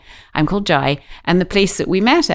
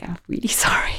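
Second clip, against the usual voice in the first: whispered, gentle apology with a small laugh; soft refusal; 6 dB quieter than usual. Retold or imitated. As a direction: let him down in a near whisper. Softly turning down his question.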